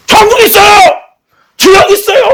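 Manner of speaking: high, loud impassioned plea, acted; re-enacted wailing insistence; tearful shouting. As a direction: cry out desperately as if pleading through tears. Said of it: "막 눈물을 흘리고 막 그랬다고".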